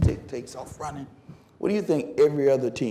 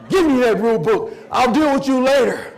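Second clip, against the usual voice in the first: loud, pitched-up dismissal; exasperated coach; 7 semitones higher, 9 dB louder than usual. Retold or imitated. Coach taking rule book from the runner, exasperated loud order. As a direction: cut off the argument with a raised voice.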